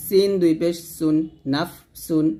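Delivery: সুন with a thin, light s, never a heavy sad; thin sin. Shown as a demonstration. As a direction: spell out সিন দুই পেশ সুন carefully with a thin s. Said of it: "পাতলা করে বলতে হবে".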